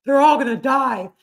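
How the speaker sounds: solemn doom-laden warning; spirit voice. Retold or imitated. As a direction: intone it like a dire prophecy. Spirit guide quoted.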